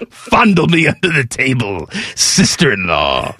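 slow celebrity impression sinking low at the end; hammed-up talk-host voice, dropping deep; Larry King. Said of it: "Larry King turned into Jiminy Glick".

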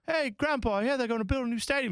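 put-on very high, quick kid voice; kids' voice. Kids telling Grandpa about the stadium, high child voices. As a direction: call out high, like a grandchild with news. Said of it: "the kids are going".

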